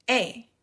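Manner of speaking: E said as English "ay"; English letter name. Shown as a demonstration. Teacher models the letter for Spanish-speaking learners, English letter name. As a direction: say E like the "ay" in "day". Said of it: "se pronuncia esta letra como".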